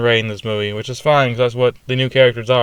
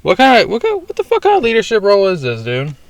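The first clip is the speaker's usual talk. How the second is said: much higher, exasperated disbelief; incredulous outburst; 7 semitones higher than usual. Real, not acted. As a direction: throw it up high in outrage. Outraged rant about Holdo.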